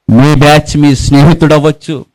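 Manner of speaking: ordinary talk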